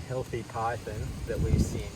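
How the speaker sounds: normal talking voice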